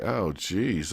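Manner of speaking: a quiet, beaten mutter under the breath; softly deflated groan of defeat; deflated oh geez. Retold or imitated. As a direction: sigh it out low and soft, crestfallen. Couple hears neighbor criticism.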